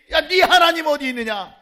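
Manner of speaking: high, loud jeer; mock taunting shout. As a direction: imitate friends challenging a believer at full voice. Acts out friends taunting the believer.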